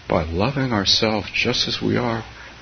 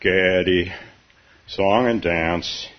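low, slow, mock-glum donkey; gloomy Eeyore; 4 semitones lower, 4 dB louder than usual. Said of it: "said Eeyore".

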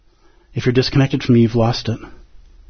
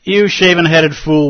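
louder and higher, slow, biting scold; scolding rebuke; 6 semitones higher, 6 dB louder than usual. Acted. Grieving old woman scolding the meddling priest, loud and slow. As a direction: throw it back at him in full scorn. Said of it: "and scolded him".

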